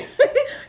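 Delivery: loud high laugh; high squealing laugh. Real, not acted. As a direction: crack up at the joke. Laughing at own joke.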